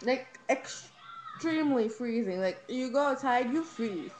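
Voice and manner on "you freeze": ordinary delivery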